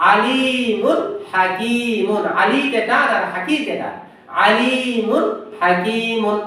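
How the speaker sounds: আলিমুন হাকিমুন drawn out, clear n before ha; slow recitation. Shown as a demonstration. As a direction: chant আলিমুন হাকিমুন slowly. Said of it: "যেটা কঠিন মনে হয়".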